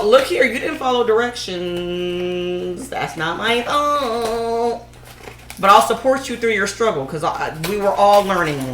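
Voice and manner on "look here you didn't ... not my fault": sassy scolding. Pretend. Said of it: "And I'm gonna go".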